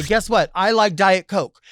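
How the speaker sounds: high, loud, slowish proud statement; bold declaration. Pretend. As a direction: announce it defiantly. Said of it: "And I'll say".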